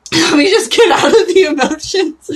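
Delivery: high, loud laugh through the words. Real, not acted. Host pulling herself together after crying, laughing. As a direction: shake off the tears with a laugh.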